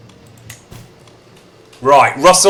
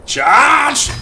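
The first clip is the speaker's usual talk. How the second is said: battle cry; 12 semitones higher than usual. Pretend. Leading the band's charge.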